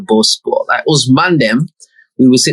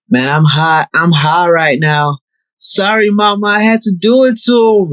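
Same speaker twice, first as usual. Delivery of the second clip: higher, playful [name] impression; imitating [name]; 6 semitones higher than usual. Friend's song plays, imitating the rapper's intro higher. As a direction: mimic the rapper's spoken intro.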